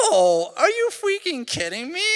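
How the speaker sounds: put-on high, loud indignant little girl; sassy toddler. Dad retelling daughter's cheeky retort, high sassy child voice. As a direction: squeal it like an offended toddler, half laughing. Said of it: "She goes".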